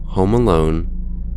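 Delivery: usual speaking voice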